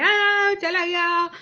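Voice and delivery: a hint of a mother's high wail; anguished cry. Describing the picture, lightly voicing the mother's high cry. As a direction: cry out he is gone. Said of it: "Mrs TV कह रही हैं".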